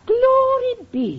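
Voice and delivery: high, slow awed exclamation; marveling at the news; the landlady marvels at the heiress; amazed. Acted. O'Reilly hears of the fortune.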